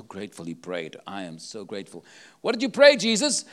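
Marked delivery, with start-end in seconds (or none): 2.4-3.4 eager question. Acted. Preacher asks Jesus in mock dialogue.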